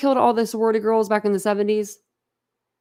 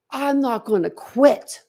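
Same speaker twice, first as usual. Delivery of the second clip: mock-dramatic lawyer, slow and grand; imitating a theatrical lawyer, drawn out; 4 semitones higher, slower than usual. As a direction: ham up the lawyer's big stand. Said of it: "Turned around all dramatic".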